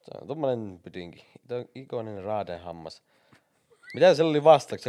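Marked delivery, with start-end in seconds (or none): 3.9-4.9 loud, high-pitched question; raised, urgent quiz-player yell; urgent shout. Real, not acted. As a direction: shout the question in a rush of panic. Frantic guessing in timed quiz.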